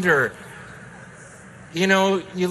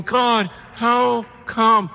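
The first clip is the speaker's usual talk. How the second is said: slow, drawn-out plea; begging; 5 semitones higher, much slower than usual. Retold or imitated. Pastor begging God in frustration, slow. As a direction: stretch it out, pleading. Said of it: "And I'm begging".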